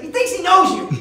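high, very loud exasperated repeat; fed-up shout to get through; shouted punchline. Retold or imitated. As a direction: yell it at someone hard of hearing. Shouted answer to the passenger, punchline.

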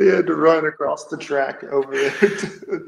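high, laugh-filled voice; laughing hard. Real, not acted. Cracking up over the player's run across the track, laughing. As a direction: push the words out through real laughter.

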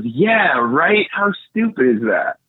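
high, sneering yeah right, half-acted; mildly acted scornful stranger; mocking agreement. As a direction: play a scoffing stranger. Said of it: "the person in front of me turns around and goes".

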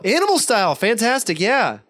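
high, gushing echo of an order; mock over-the-top customer-service enthusiasm; overfriendly drive-thru guy. Employee gushingly echoes the order.

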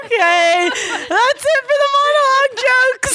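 high, laughing through the words; can barely get it out laughing. Real, not acted. Host laughing while wrapping up.

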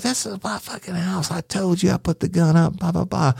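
high-pitched imitation of an angry, cursing woman; Miss Mary imitated. Deputy retelling the woman yelling back at him, high mock-angry voice. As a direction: squeak her up high, all attitude.

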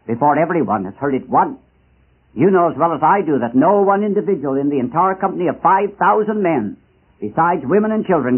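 ordinary talk, nothing marked

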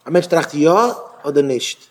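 ordinary talk

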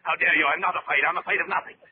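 high, indignant barking; offended officer shouting down a subordinate; furious outburst. Acted. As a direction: lash out to hide his fear. Officer insulted, denies fear.

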